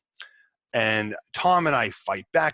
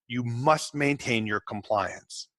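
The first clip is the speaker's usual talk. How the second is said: normal talking voice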